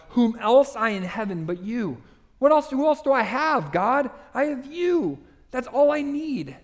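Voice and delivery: high, impassioned plea to God; crying out to God. Acted. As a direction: cry it out in rising, fervent pitch. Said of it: "he cries out".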